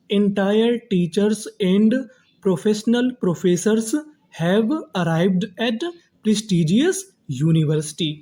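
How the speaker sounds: very slow, separated dictation-style reading; slow word-by-word reading. Shown as a demonstration. Teacher reading the built sentence to students, slow and word by word. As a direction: read the sentence slowly, pausing between words. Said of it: "तो पूरा हमारा sentence क्या बना".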